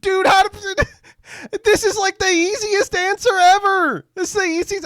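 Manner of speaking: very high, loud squeal of disbelief; cracking up, voice shooting way up; incredulous laughing. Real, not acted. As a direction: cry out through real laughter. Incredulous laughter at the sheriff's answer.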